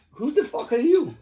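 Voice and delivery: incredulous mock challenge to the bossy man; mock outburst. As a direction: throw it back high and loud. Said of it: "It's like".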